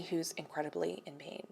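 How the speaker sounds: hushed whisper. Real, not acted. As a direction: whisper it gently. Whispers about painful pain.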